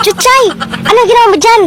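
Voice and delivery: high, lively call to a friend; excited, high-pitched greeting. Acted. Calling out to a friend.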